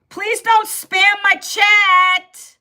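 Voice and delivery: drawn-out, high, loud plea to chatters. Real, not acted. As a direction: call it out slowly and loudly. Scolding a chat spammer live.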